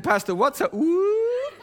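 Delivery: high, comic questioning voice; playful squeaky question; mock puzzled listener. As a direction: imitate a baffled churchgoer, sliding up on "ooh".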